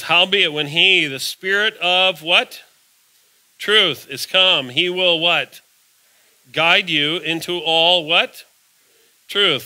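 slow, loud reading that pauses to ask the congregation what; call-and-response scripture reading. Acted. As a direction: drag each phrase out, then toss the question to the pews. Reads scripture prompting congregation.

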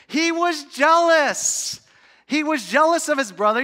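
high, loud proclamation; emphatic preaching. Real, not acted. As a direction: thunder out the answer to the congregation. Preacher answers own question at full force.

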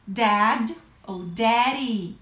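normal talking voice

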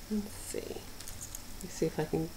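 soft, slow murmur while untangling; half-whispered, mostly to yourself; hushed and slow; murmured to self. Real, not acted. Quietly fixing tangled toy.

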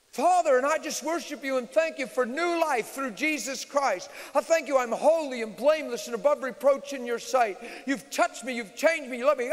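loud, high, fervent praise; ballistic prayer. Retold or imitated. Preacher praising God loudly before his students. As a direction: pour it out at full volume. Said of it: "I just went ballistic".

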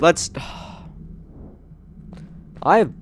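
long tired sigh mid-sentence; exasperated sigh. Real, not acted. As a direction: let the frustration out in a breath. Frustrated gaming sigh.

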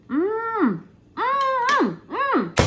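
long, high-pitched "mmm" of enjoyment; delighted tasting hum. Real, not acted. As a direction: hum with pleasure over a first bite.

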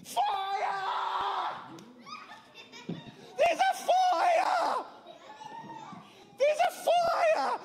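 high, loud alarm cry; high panicked shout of fire. Acted. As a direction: yell the warning to everyone. Monkey raises the alarm.

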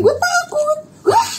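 very high-pitched mock scream; retold frightened scream. Screaming back at the apparition, very high mock shriek. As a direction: let the words climb into a shriek. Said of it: "saya gituin".

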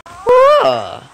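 high, loud startled "whoa"; startled whoop. Real, not acted. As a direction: cry out as if caught off guard. Sudden surprise in game.

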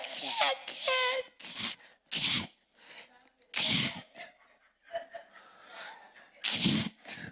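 repeated sharp voiceless bursts; shooing a dog. Acted. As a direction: keep spitting out a shooing sound. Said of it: "If a dog chase you".

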